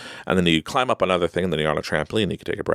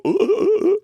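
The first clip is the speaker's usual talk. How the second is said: high, hooting animal barks; sea lion noise; 5 dB louder than usual. Acted. Dad doing sea lion noises for his kids, playful high hooting. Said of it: "My kids make me go".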